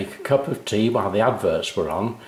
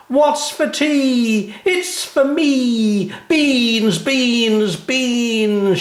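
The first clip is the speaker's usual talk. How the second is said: very high, slow, loud chant of an advert; shouted jingle; 14 semitones higher, 4 dB louder, much slower than usual. A narrator's reading. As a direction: belt it out like a child on the sofa. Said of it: "she would shout at the top of her voice".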